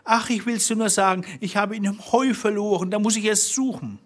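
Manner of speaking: high, quick pleading in a woman's role; high-pitched nervous confession; pleading wife. A narrator's reading. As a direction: play the cornered wife making excuses.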